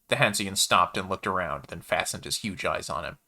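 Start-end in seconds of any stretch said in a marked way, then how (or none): none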